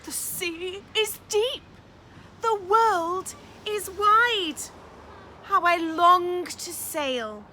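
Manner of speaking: dreamy tiny snail yearning for the sea; longing snail. Acted. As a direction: sigh out the snail's wish to sail. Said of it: "said the tiny whale".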